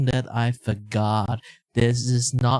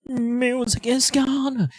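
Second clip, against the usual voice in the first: "Music is gone" said higher and slowly drawn out; higher, slower repeat; 8 semitones higher than usual. Shown as a demonstration. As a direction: lift the pitch and stretch "gone". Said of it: "Oh yeah, the music is gone".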